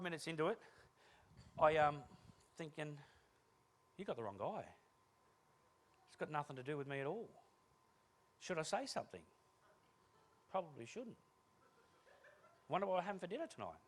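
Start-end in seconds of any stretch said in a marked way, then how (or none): 4.0-13.8 softer musing to oneself; tuned-out inner monologue. Acted. Bored guest's private thoughts in a meeting, soft musing. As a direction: let the thoughts drift, softly, with gaps.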